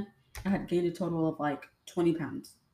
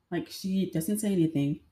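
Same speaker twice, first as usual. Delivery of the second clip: ordinary delivery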